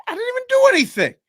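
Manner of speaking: high, loud, fast mock-whiny protest. Traveller protesting his innocence, high and whiny. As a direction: blurt it like a wronged kid. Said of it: "And I was like".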